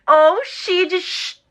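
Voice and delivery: "she" drawn out from a hushing "sh"; shushing "she" teaching the sound; slow sh sound. Shown as a demonstration. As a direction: stretch the "sh" of "she" slowly. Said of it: "ou pensar em xixi".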